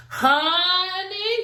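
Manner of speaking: high, long rising "honey"; scandalised reaction. Real, not acted. Reacting to Brett's remark about money, drawn-out disbelief. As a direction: stretch it upward in disbelief.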